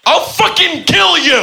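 high, slow squeaky mock threat; quoted threat. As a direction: squeak out the threat like a silly tough guy. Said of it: "and I'm quoting".

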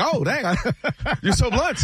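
high-pitched reply broken by a laugh; laughing reporter. Retold or imitated. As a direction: play her as laughing at his bluntness. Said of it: "She'd be like".

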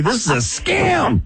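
high, drawn-out cry of accusation; mock alarm shout. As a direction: call it out in a hammed-up high voice. Acts out exposing a scam caller.

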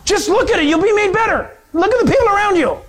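high-pitched, insistent appeal; urgent urging. Acted. Friend pressing bitten man to look, urgent urging. Acts out someone urging the scoffer.

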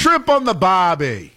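put-on Australian accent. Co-host keeps the Aussie joke going in a slow mock Australian accent. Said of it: "That's an Australian accent".

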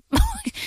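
high laughing burst; burst of laughter. Real, not acted. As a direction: laugh out at the nonsense. Reacting to the jargon.

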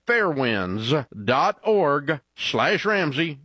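spaced-out web address, slowly; slow, deliberate repeat. Real, not acted. Ad host repeating the website for listeners, slow and deliberate. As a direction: read the address slowly, piece by piece.

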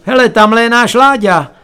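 high, loud call of recognition; calling from above. A narrator's reading. Relatives upstairs spotting Láďa at the ball, calling out. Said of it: "ozvalo se najednou zhora".

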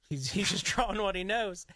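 laughing through the quote. Retold or imitated. Parents joking about the drawing.

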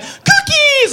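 very high delighted squeal of cookies; excited squeal. Acted. Doughboy seeing cookies come out of the oven, a high excited squeal. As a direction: squeal with childlike amazement. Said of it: "and he'll be like".

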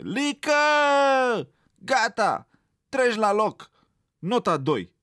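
put-on old teacher yelling at the rabbit in class; outraged yell. A narrator's reading. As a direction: scold the pupil in a fury. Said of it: "continuă să țipe ea revoltată".

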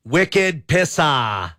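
put-on slow, drawn-out Boston accent. Host reading a firework name, in a Boston accent. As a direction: stretch it out as a hammy Bostonian. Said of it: "say that in a Boston accent".

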